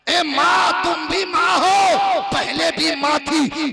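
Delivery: loud, rising fervent preaching; preaching at full force. Acted. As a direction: build to a ringing climax for the crowd. Fiery religious speech on mothers.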